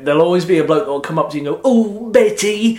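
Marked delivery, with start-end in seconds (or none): 1.6-2.8 high, loud, drawn-out catchphrase; dated catchphrase impression. Bloke coming up to perform at comedians, hammed-up catchphrase voice. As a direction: stretch both words out in a put-on voice. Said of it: "the impressions are always of an age".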